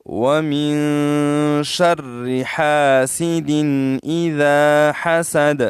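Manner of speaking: very slow, drawn-out tajweed recitation; slow melodic Quran recitation with heavy قاف; Quranic recitation. Shown as a demonstration. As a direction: chant each word with full tajweed, stretched long. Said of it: "وقف کی وجہ سے ہم ساکن کرتے ہیں".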